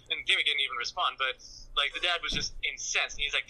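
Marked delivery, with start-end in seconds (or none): none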